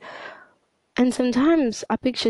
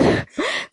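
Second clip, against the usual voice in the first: short laugh; 13 semitones higher than usual. Real, not acted. Laughs at talking so much.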